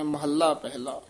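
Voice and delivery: normal talking voice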